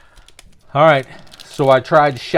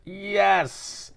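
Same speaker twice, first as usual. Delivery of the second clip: excited yes; 7 semitones higher, 4 dB quieter than usual. Real, not acted. Pulls a favourite player's card.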